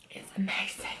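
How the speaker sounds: breathy whispered praise. Real, not acted. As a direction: whisper it close to the mic. Whispering while applying makeup.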